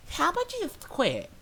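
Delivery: high-pitched mimicked suggestion; mock her dismissal, voice way up; snippy Karen. Customer telling the cashier to quit, dismissive. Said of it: "about her saying".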